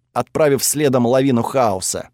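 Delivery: plain reading voice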